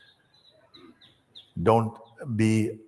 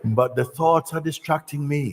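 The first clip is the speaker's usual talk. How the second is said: higher, complaining seeker; whiny complaint; 7 semitones higher, 3 dB louder than usual. Retold or imitated. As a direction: whine it to the teacher. Disciple complains.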